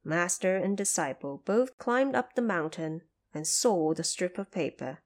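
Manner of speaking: plain reading voice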